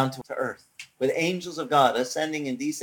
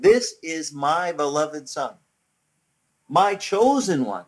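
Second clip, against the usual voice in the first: slow, projected divine proclamation; voice over a loudspeaker; 3 dB louder, slower than usual. Retold or imitated. God's voice from heaven over the loudspeaker, slow proclamation. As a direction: announce it big, stretching each word. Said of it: "over the loudspeaker said".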